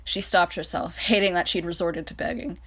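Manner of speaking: ordinary delivery, nothing marked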